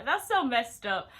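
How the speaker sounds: loud, slow indignant cry; loud disgusted exclamation; outraged. Real, not acted. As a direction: let the outrage burst out. Outraged at catfishing.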